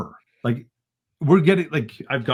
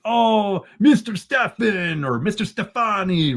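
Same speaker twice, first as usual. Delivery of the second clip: high, slow put-on witness voice; hammed-up imitation of the evasive witness; mocking the witness; 8 semitones higher, slower than usual. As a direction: drag out the name like a flustered witness. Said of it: "He'd say stuff like".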